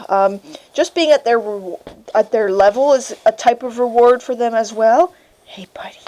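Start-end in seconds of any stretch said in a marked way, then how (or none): 5.4-6.0 soft whisper. Real, not acted. Cooing to puppy.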